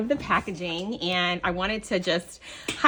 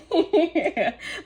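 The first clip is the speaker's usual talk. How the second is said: light giggle; 9 semitones higher than usual. Real, not acted. Delighted laugh opening swap gift.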